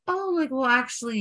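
high-pitched, tentative acted correction; hesitant correction. Correcting whoever misgendered them, high and tentative. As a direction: correct someone in a high, careful voice.

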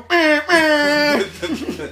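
high, loud brr through laughter; laughing brr noise. Acted. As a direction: trill it while cracking up.

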